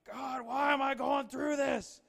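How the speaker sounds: high, drawn-out yell of complaint; yelling at God. Acted. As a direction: cry out at God in protest. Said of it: "start yelling at him saying".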